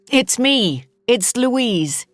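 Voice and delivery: loud to be heard over screams; speaking loudly. Acted. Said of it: "I have to speak loudly to be heard".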